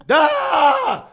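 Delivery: loud, high-pitched zombie lunge cry; hammed-up attacking shriek; biting-attack scream. Uncle trying to bite nephew, acted scream.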